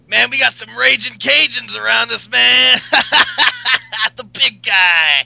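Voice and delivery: hammed-up loud, high wrestler hollering; Ryback. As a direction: shout with a forced laugh, drag out the catchphrase. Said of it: "he's like".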